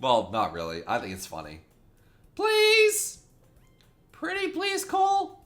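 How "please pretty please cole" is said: put-on very high, wheedling girly voice; pleading girl character. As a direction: coax him in a put-on girl's voice.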